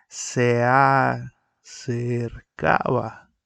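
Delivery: "Se acercaba" drawn out slowly, se and a joined; slow teaching demo; slow syllable count. Shown as a demonstration. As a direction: stretch "acercaba" to show the sinalefa. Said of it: "Se puede convertir en una sola".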